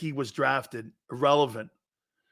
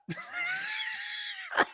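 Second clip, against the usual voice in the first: high, breathy laughing fit; real wheezing laugh; 10 dB quieter than usual. Real, not acted. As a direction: crack up at your own joke. Cracks up at his own joke.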